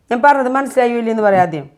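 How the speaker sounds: ordinary delivery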